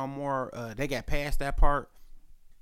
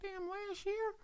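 much higher, slow disbelieving; high, stunned question; 14 dB quieter, slower than usual. Retold or imitated. Hearing about grandma, slow high disbelief. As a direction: draw it out high in shock. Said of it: "I'm like".

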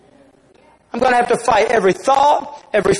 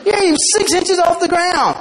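high-pitched, laughing big-dog taunt; mocking laughter; 9 semitones higher than usual. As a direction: hoot with laughter while jeering at a runt. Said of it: "started laughing, just laughing".

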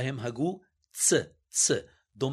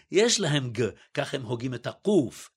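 ordinary delivery

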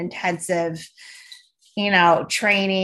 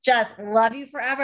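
normal talking voice

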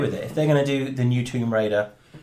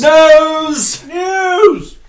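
shouted announcement; 15 dB louder than usual. Acted. Echoing the news call.

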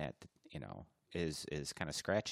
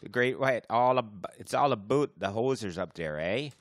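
higher, louder hammed-up Canuck voice; mock Canadian accent; 7 semitones higher, 9 dB louder than usual. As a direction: play a beer-loving hoser. Mock Canadian hoser bit.

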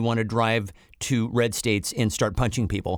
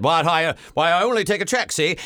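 put-on old-movie mid-Atlantic accent, higher and clipped; 5 semitones higher, 3 dB louder than usual. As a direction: play it like a 1930s film clerk in mid-Atlantic. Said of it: "You should actually have to say that in a mid-Atlantic accent".

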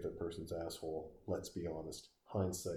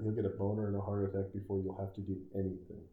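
ordinary talk

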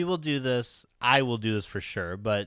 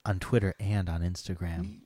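sexy voice; 7 semitones lower, 4 dB quieter than usual. Pretend. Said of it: "this sexy voice".

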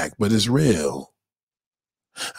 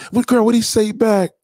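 higher, eager gossiping voice; excited friend; 9 semitones higher, 4 dB louder than usual. Acted. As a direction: lean in for the gossip.

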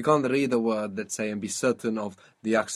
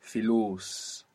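Filus with a drawn-out, stressed LUS; stress on long vowel. Shown as a demonstration. Teacher confirming the long vowel, stress on LUS in Filus. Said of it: "because there's a long vowel".

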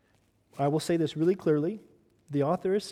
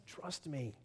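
much softer, intimate; soft command; 3 semitones lower, 15 dB quieter than usual. Acted. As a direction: say it almost under your breath. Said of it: "he commands us".

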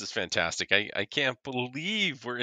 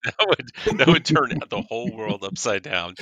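laughing; 8 dB louder than usual. Real, not acted. Laughing excitedly at the soccer idea.

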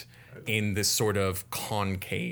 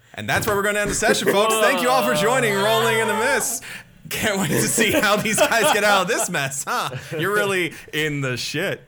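higher, louder, fast host sign-off; show outro; 12 semitones higher, 9 dB louder, faster than usual. Real, not acted. Host signing off the session, fast and upbeat. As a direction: wrap up the stream cheerfully.

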